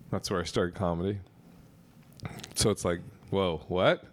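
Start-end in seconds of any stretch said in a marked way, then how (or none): none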